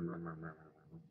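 soft mumbled murmur, murmur, murmur; murmur, murmur, murmur mumbled very softly; murmuring demo. Shown as a demonstration. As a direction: murmur it under your breath like a crowd. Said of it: "it sounds like when people are murmur".

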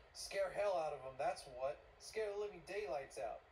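loud, heated retort; defiant outburst. Acted. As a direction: fire back loudly and defiantly. Montag snaps back at Faber.

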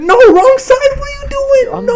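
high, loud frantic cry; panicked yelling at teammate. Real, not acted. Gamer yells at teammate on wrong side, frantic.

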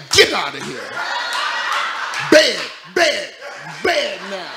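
loud sharp command; scolding the dog. Retold or imitated. Owner ordering dog to bed, loud.

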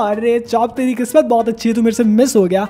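ordinary delivery

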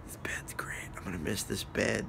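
soft whispered aside. Real, not acted. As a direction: whisper it to yourself. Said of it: "whispered aside".

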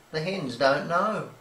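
plain reading voice

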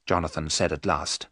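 ordinary delivery

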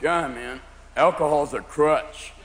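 low, slow stoner drawl; sluggish, stoned and preachy; stoner friend. Acted. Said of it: "your friends who smoke marijuana going".

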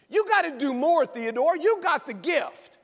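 a hint of an emphatic teacher; teacher urging. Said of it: "She says".

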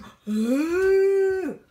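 loud high mock gasp; big gasp. Shown as a demonstration. Owner shows the dog her loud high mock gasp. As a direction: gasp hugely for show. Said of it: "And you go".

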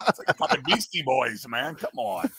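ordinary delivery

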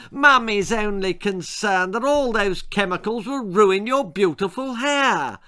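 high-pitched simpering mother. A narrator's reading. Mother frets about her daughter's hair, simpering. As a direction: fuss sweetly over a precious child. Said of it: "simpered her mother".

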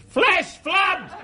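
mock warning shout; high yelled warning. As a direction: cry Flash flood way up high. Soldier shouting diversion word.

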